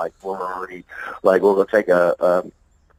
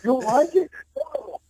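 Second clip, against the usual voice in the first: high retold banter. Retold phone banter with songwriter.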